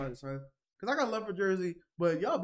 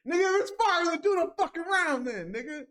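much higher and louder, fed-up rant; exasperated yell; 10 semitones higher, 4 dB louder than usual. Real, not acted. Fan yelling at a rapper who skipped his round. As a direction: yell at him to just do the round.

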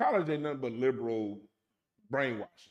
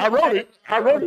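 repeated I wrote it, louder each time; loud mocking; 7 semitones higher, 13 dB louder than usual. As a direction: shout it insistently.

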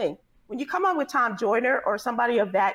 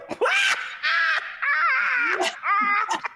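long, high-pitched wail into the mic; imitated sudden yell. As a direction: mimic someone jolting awake with a drawn-out yell. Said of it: "do you remember she yelled in the mic".

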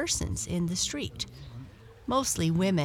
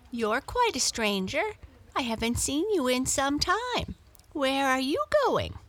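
higher, curious cat voice; a friendly neighbour cat asking questions; Muffins the cat; 8 semitones higher than usual. A narrator's reading.

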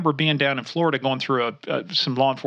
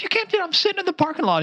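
high squeaky protest, much higher; indignant protest; faster than usual. Retold or imitated. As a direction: squeal it out in disbelief. Protesting driver in joke.

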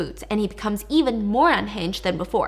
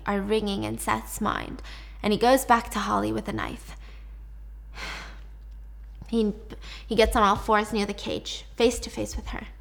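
usual speaking voice

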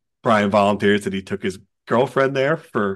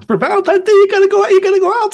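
high, loud squeaky voice; hammed-up high plea; mock falsetto plea; 9 dB louder, faster than usual. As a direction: pitch it way up in mock protest. Jokingly defending pricey date.

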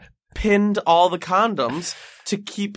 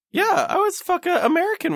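excited. Real, not acted. Joking mishearing, high pitch.

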